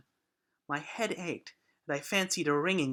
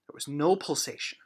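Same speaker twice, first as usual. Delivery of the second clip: ordinary delivery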